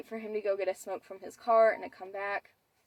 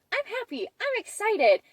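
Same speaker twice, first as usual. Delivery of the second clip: much higher, getting louder; imitating happy dad; 13 semitones higher, 6 dB louder than usual. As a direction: play the dad beaming with joy, voice way up. Dad answers the mom.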